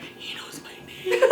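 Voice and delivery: breathless whisper of wonder; awed whisper. Retold or imitated. Teen [name] walking away from Mickey, awed whisper. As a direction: whisper it like a starstruck kid. Starstruck girl after meeting Mickey.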